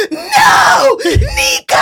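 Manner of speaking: sudden high shout of the name. Retold or imitated. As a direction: yell for Nika in mock panic. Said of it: "she goes".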